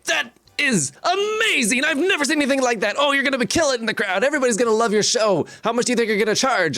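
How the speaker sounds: high, awestruck barker gushing; wide-eyed amazement. Acted. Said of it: "you see his eyes go wide".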